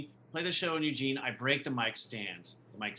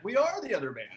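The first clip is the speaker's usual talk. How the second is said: mock indignant drummer; 9 semitones higher, 4 dB louder, faster than usual. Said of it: "I remember he's like".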